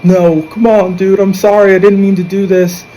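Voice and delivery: high, imploring retold plea; imitating someone begging forgiveness, voice pitched high; pleading apology. Retold shooter apologizing, pleading with victim.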